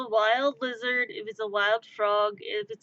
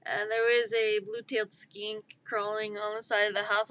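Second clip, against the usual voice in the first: usual speaking voice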